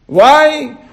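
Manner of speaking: normal talking voice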